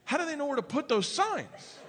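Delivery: high-pitched mock kid question; questioning daughter. Daughter asks dad about the signs, puzzled. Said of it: "She goes".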